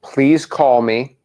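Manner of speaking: very slow, spaced dictation; dictating. Shown as a demonstration. Coach dictating the request to trainee, very slow dictation. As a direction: hand over one word at a time. We're gonna write it.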